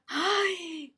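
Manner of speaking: high, light laugh into ay; real amused laugh. Real, not acted. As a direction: laugh with delight at the news. Host laughs at the story.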